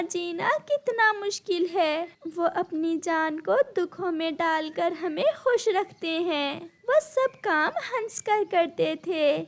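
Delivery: high, mournful bird voice; grieving widowed bird. Acted. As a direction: recall him sorrowfully in a high voice.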